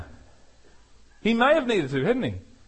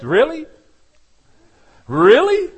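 loud, high disbelieving really; a loud sarcastic really; incredulous; 6 semitones higher, 6 dB louder than usual. Real, not acted. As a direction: challenge them with disbelief. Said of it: "incredulous repeated question".

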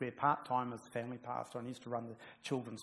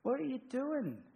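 mock bewildered magicians; 9 semitones higher, 7 dB louder, slower than usual. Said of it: "It's all".

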